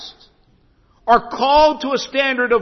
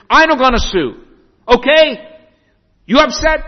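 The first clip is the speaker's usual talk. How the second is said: anxious broken English; 6 semitones higher, 4 dB louder than usual. Retold or imitated. Husband checks if pastor upset.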